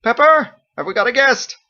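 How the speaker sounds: high, loud call down the hall; calling from the kitchen. A narrator's reading. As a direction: shout it from another room. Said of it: "called out from the kitchen".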